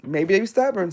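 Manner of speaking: casual vernacular "Maybe they stubborn" with no "are"; dropped "are" in "Maybe they stubborn". Shown as a demonstration. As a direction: say "they stubborn" loosely, skipping the verb. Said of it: "Maybe they're stubborn".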